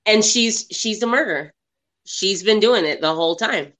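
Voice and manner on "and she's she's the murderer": normal talking voice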